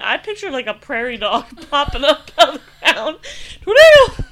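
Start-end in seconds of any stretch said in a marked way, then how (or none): 3.6-4.3 high, loud squeal of "Tornado!"; squeaky critter shout. Pretend. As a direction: shriek it like a tiny prairie dog. Prairie dog pops up warning.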